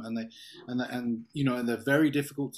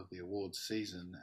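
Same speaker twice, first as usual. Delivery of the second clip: ordinary talk, nothing marked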